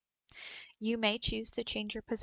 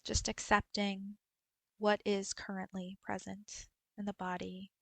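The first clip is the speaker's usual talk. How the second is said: guided meditation. Acted. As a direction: guide them gently through a body scan, unhurried. Leads a body scan meditation.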